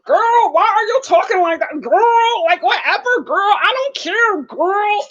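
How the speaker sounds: high, sassy put-on drawl; Brittany brushes off Yazan, high and sassy; mock Brittany. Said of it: "she was like".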